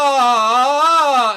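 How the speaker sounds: loud high sustained ah; sung tone demo. Shown as a demonstration. Teacher singing with hand on student's belly, loud sustained ah. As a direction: belt it out to show the breath.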